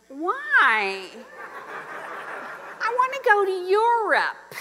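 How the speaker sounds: drawn-out, complaining "why"; whiny protest. Retold or imitated. Wife objecting to husband's vacation plan, whiny protest. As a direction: plead like a balky disciple. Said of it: "I'm like the disciples".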